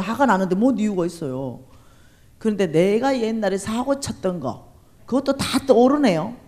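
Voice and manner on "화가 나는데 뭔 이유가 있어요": ordinary talk, nothing marked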